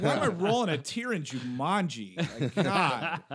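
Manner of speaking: high, loud, laughing disbelief; laughing at himself. Real, not acted. Dad laughing to friends about crying at Jumanji, giggly. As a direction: laugh it out, embarrassed by the tears. Laughing at his own tears.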